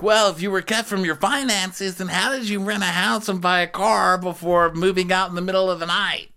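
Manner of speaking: high-pitched mock impression; Andy. Andy grilling her about finances, high mock interviewer voice. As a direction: hold a put-on high voice through the whole question. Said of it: "So Andy's like".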